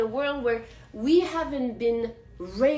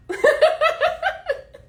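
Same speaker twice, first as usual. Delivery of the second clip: high laughter; 6 dB louder than usual. Real, not acted. Vlogger cracking up at her own rant, high laugh.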